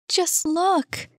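high, enchanted exclamation; awed, high-voiced delight; breathless exclamation. A narrator's reading. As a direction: exclaim in wonder, pitched high. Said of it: "she exclaimed, breathless".

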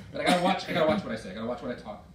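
ordinary talk

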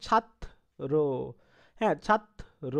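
ছাত্র said as "chhattro", the ত doubled; pronunciation demo. Shown as a demonstration. Teacher shows the class the doubled ত in ছাত্র. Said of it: "তটা কিন্তু দুইবার হয়ে যাচ্ছে ছাত্র".

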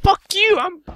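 very high, loud cartoon Pokemon voice; hammed-up tiny Pokemon yelling; Shaman's squeaky voice. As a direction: squeak out a cartoon creature's insult. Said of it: "He'll be like".

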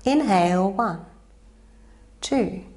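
slow, even counting of each breath. Acted. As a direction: count the breaths calmly, one by one. Yoga teacher counts breaths.

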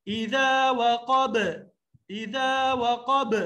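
slow اذا وقب with a bounce on the final ب; qalqala on ba. Shown as a demonstration. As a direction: echo the ب of وقب. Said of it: "یہاں پہ بھی قلقلہ کریں".

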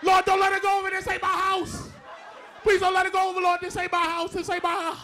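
frantic prayer as the toilet water rises in a friend's house; panicked prayer. Pretend. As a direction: beg God like the water's rising. Praying the toilet won't overflow.

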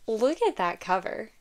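ordinary talk, nothing marked

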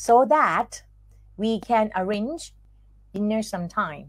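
careful dictation pace, one chunk at a time; slowed-down chunked reading. Shown as a demonstration. Teacher slowing the sentence down for students to repeat, in chunks. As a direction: break it into short phrases with long pauses. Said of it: "ลองพูดดู เอาพูดเร็วไป".

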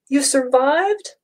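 high, slow wondering question; hardly believing it; amazed. A narrator's reading. Reunion with mother.

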